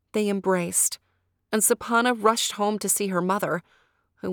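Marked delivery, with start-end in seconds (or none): none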